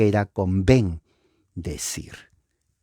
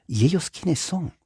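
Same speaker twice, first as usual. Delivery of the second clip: voz aguda curiosa; 8 semitones higher than usual. Pretend. Diálogo imaginado, curioso.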